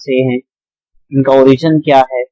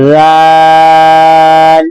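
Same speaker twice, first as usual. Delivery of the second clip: دال chanted slowly, held for five counts; long daal; 5 dB louder, much slower than usual. Shown as a demonstration. Teacher chants the long vowel in دال for the class. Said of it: "اسی طرح زال بھی".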